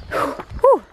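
a high puffed-out exhale of effort; winded whew. Real, not acted. Climbing a steep hill in trainers, a winded whew. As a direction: blow out air like you've just climbed hard. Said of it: "out of breath".

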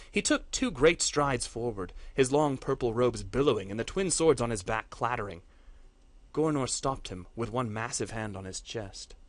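usual speaking voice

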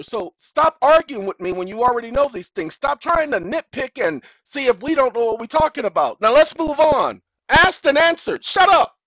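shouted high and loud, rising to a furious peak; angry rant. Acted. Firing back at the court's nitpicking, furious shout. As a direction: tell the court off and shut them down. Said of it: "that's my response to them".

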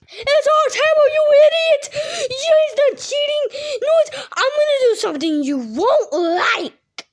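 high, loud angry shout; furious shouting. Acted. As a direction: scream insults at him.

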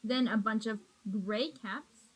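plain reading voice

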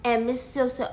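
usual speaking voice

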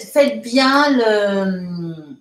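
ordinary talk

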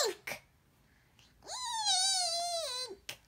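very high shrieking eek; a startled girl shrieks as the snake moves; squeal of fright. A narrator's reading. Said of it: "her mouth open wide".

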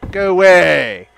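loud go away; loud shooing. Acted. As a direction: shoo her off. Chasing away Dune lady.

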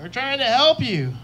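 high, pleading wannabe hero; fake Batman. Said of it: "the fake Batman in The Dark Knight".

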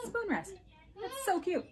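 high cooing voice; cutesy high voice. Real, not acted. Cooing over cute spoon rest.

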